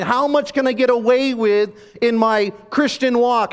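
high, loud, driving preacher's cry; preaching at full force. Acted. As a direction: lift the congregation, hammering each phrase. Preacher builds to a peak.

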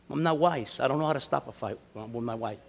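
normal talking voice

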